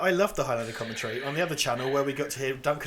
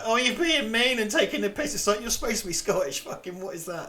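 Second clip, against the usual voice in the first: higher-pitched mimicked complainer; mock outrage; 7 semitones higher than usual. Imitating people offended by the Scottish accents, mock-indignant. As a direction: put on an indignant objector's whine, mockingly.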